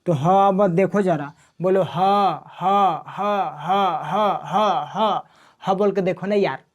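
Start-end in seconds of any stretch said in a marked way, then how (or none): 1.8-5.2 ह sounded alone from the throat, repeated with pauses; throat sound demo. Shown as a demonstration. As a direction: push each separate ह out from the throat. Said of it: "साफा कंठ पर जोर पड़ रहा है".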